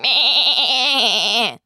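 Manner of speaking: bleating goat "meh". Retold or imitated. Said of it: "That was an incredible goat".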